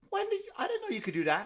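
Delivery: put-on high, laughing old-mother voice; imitating his mother, amused; mother's voice. Mimicking the customer's mother in a high laughing voice. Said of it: "his mother goes".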